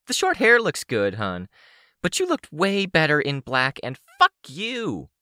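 high-pitched taunting villainess; high, scornful character voice; foul-mouthed villain woman. Acted. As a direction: needle her in a high, hostile voice. Sidepiece taunts Swansong.

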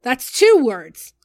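high, loud, drawn-out comeback; shouted retort. A narrator's reading. Side Piece answering Nailbiter mid-fight, shouted retort. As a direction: yell it back across the fight.

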